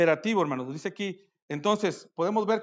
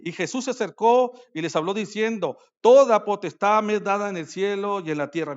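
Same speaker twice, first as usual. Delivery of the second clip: loud, proclaiming Bible recitation; scripture reading; 3 semitones higher, 9 dB louder than usual. Acted. Pastor reading Matthew to the congregation, loud proclaiming read. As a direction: declaim the verse like a herald.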